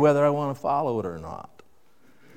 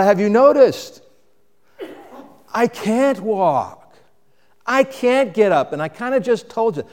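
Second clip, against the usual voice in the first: lightly voiced sarcastic man; sarcastic cripple; 7 semitones higher, 5 dB louder than usual. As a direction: play the lame man answering back, a bit higher. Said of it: "natural thing for this man to think".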